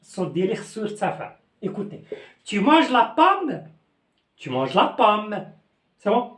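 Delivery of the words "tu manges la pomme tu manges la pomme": tu manges la pomme asked slowly with pitch rising to la pomme; slow, high questioning intonation on tu manges la pomme; rising question tune. Shown as a demonstration. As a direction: ask it as a question, letting the voice climb. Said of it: "je dois remonter l'intonation".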